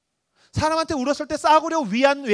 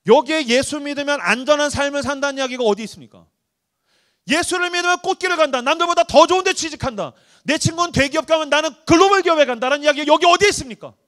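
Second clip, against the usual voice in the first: rising, louder preaching voice; impassioned preaching; 4 semitones higher, 4 dB louder than usual. Acted. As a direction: preach it with building force. (열정적인 설교)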